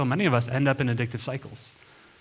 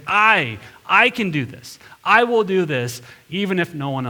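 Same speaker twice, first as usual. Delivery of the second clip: louder and higher, hammering each "I"; stubborn, raised declaration; insisting; 5 semitones higher, 5 dB louder than usual. Retold or imitated. Preacher acting Peter refusing Jesus, loud insistence. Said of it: "He is insisting on his way".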